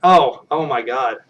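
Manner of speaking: ordinary delivery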